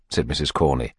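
ordinary delivery

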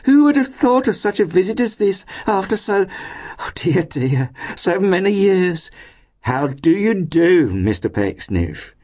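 put-on overjoyed landlady greeting an old friend; effusive cry. A narrator's reading. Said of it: "cried Missus Todgers".

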